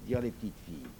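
plain reading voice

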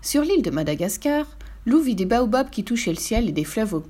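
ordinary delivery, nothing marked

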